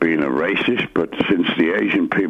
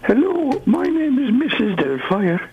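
very high falsetto lady; ropey high-pitched character impression; Mrs Doubtfire. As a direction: put on a squeaky film-nanny greeting. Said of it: "doing a Missus Doubtfire impression".

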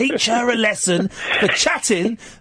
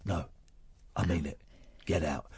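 deep, very quiet, deadpan order; 15 dB quieter than usual. Acted. Star guitarist repeating that she must leave, low and hushed. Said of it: "and he'd say".

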